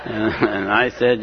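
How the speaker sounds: ordinary talk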